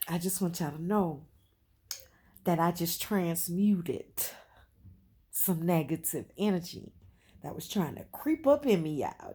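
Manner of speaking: loud, emphatic announcement to the camera; loud declaration. Real, not acted. Vlogger to her viewers, a loud fired-up declaration. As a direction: belt it out with fired-up conviction.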